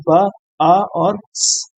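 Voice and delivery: ब, अ, स slowly, each sound by itself; three separate sounds. Shown as a demonstration. Teacher showing the three sounds in bus, sounded out separately. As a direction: sound out ब ... अ ... then a hissed स. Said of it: "तीन different sound हैं".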